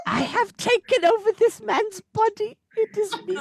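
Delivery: hammed-up high singer impression. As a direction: intone it high like a possessing spirit.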